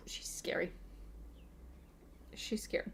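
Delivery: hushed, half-joking spooked whisper; breathless playful whisper; mock scared whisper. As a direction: whisper it like she might hear you. Whispered mock fear about Trisha.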